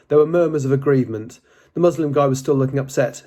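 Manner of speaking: ordinary delivery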